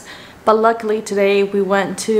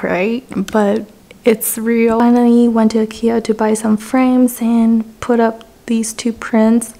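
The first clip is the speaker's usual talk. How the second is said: normal talking voice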